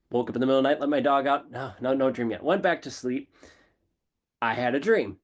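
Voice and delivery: ordinary talk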